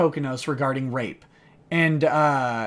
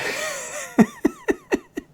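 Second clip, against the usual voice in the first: very high laughing fit; breaking into laughter. Real, not acted. Reader unable to get through the plaintiffs' claim, laughing. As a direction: lose it laughing mid-reading.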